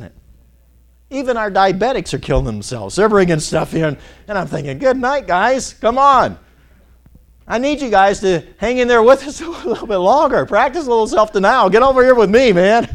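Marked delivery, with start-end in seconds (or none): 4.8-6.4 high, loud mock exasperation; exasperated plea. Dieter pleading with friends who bring food, exasperated. 7.5-12.9 high, loud comic pleading; exasperated plea. Acted. Dieter begging friends to practice self-denial with him, exasperated.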